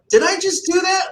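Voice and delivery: high, stunned retold disbelief; shocked disbelief. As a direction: hear yourself realize what you just did. Retold shock at own injury.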